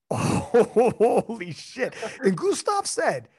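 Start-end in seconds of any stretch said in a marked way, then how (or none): 0.1-1.9 awed shock. Retold or imitated. Acts out his momentary shocked reaction.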